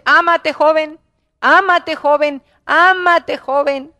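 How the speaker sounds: pleading pulpit refrain; urgent exhortation. Real, not acted. Preacher urges the young repeatedly.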